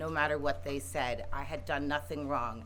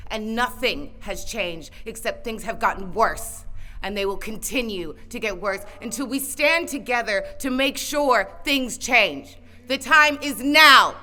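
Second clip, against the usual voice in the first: rising rally cry; 7 semitones higher, 10 dB louder than usual. Real, not acted. Rally speech building to climax.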